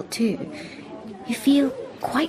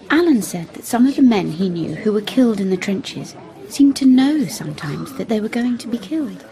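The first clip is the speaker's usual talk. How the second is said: ordinary delivery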